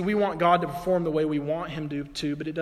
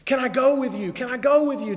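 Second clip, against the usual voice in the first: higher, louder eager pleading; begging; 8 semitones higher, 5 dB louder than usual. Retold or imitated. Freed demoniac at Jesus' ankles begging to follow, higher and louder. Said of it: "begging him".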